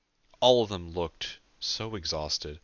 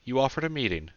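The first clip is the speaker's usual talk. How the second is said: smooth, higher-pitched statement; 7 semitones higher, 4 dB louder than usual. A narrator's reading. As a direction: state it smoothly, leaving it dangling. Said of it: "she said smoothly".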